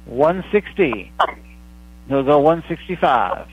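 slow auctioneer call, going once; auctioneer calling it slowly. Acted. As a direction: draw out each call before the sale. Going once, going twice, sold.

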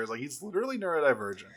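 ordinary talk

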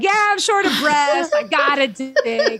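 high, giddy and loopy, laughing through it; delirious, laughing. Retold or imitated. To the doctor, a pneumonia patient rambling in high, giddy laughter. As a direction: play yourself feverish and out of your mind. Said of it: "I was so, like, out of my mind".

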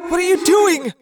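high, loud question; high-pitched, urgent shout; alarmed yell. A narrator's reading. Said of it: "Arbron yelled".

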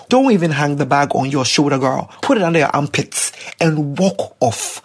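ordinary talk, nothing marked

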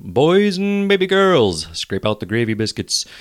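big slow announcer intro; booming kids show opener. Acted. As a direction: boom it out like a kids' TV host.

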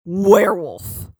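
slow, solemn werewolf; slow spooky reveal; dramatic reveal. Retold or imitated. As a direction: draw out Werewolf. slowly and ominously. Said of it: "Say it out loud".

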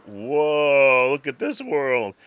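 drawn-out, slowing wonder; mock awe. Owner voicing cat seeing the outdoors, mock awe. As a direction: voice the cat's amazed discovery. Cat's first awed look outside.

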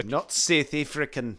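slow mock South African, "South" as "Seth"; South African accent. Host correcting co-host, mock South African accent. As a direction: squeeze the vowels into fake South African. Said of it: "with a South African accent".

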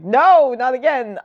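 high, loud burst on the no; hammed-up aggressive outcry; mock aggressive. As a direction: imitate an aggressive, exasperated protest. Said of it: "very aggressively being like".

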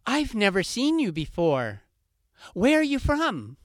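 high, loud, pushy questions; insistent fox. Acted. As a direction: fox demanding answers, voice up high.

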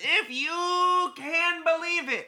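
very high, slow declaimed impression; David Lynch. Host announces that it's Friday, David Lynch impression. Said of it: "David Lynch comes and says".

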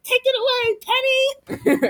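high, showy cartoon call; cartoon villain voice. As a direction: announce it like a cartoon showman.

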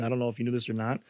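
ordinary delivery

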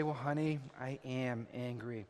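soft, low, slowing confession; meek admission. Acted. Husband outwardly admits it to his wife, meekly and softly. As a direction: drop it right down, imitating a subdued husband. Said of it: "And I'll say".

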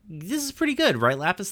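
high-pitched hopeful check-in; Peridot. Retold or imitated. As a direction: pitch way up, seeking agreement. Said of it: "Peridot's like".

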